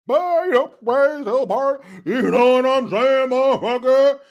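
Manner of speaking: high, loud exaggerated preachy politician; Barry Obama. Host mocks politician switching speech for the crowd, hammed-up impression. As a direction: ham up the politician pandering to his crowd. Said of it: "Depending on the crowd, that's how you speak".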